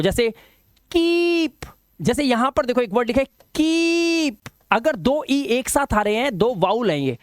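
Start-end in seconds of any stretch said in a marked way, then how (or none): 0.9-1.7 कीप held long and high, "keeeep"; stretched long vowel. Shown as a demonstration. Teacher demonstrating long vowel sound, stretched vowel. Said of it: "stretch करना है उसको". 3.5-4.4 कीप with a long sustained "ee"; stretched long vowel. Shown as a demonstration. Teacher reading the written example, stretched long vowel. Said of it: "stretch करना है उसको".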